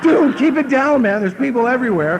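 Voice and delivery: urgent plea. Retold or imitated. Begging the man to be quiet.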